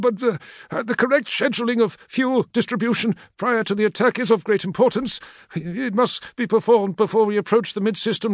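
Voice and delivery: a cowering clerk stammering excuses; frightened logistician. Acted. Fat logistician begging the War Master's equerry, high frightened voice.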